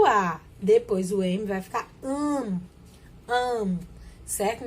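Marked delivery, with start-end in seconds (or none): 2.0-3.8 "am" as one nasal vowel, lips not releasing an M. Shown as a demonstration. Teacher showing A followed by M, nasalised vowel. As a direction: hum the A of "am" through the nose. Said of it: "um som diferente".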